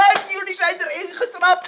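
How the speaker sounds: high pitched, laughing through the words; laughing gloat. Acted. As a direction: crow over the trick through laughter. Gloating over the prank.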